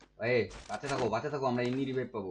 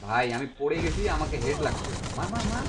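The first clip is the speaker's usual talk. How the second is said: loud, urgent battle callout; urgent shout; 4 semitones higher, 6 dB louder than usual. Real, not acted. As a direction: yell it at your teammate, high. Downed in fight, urging teammate.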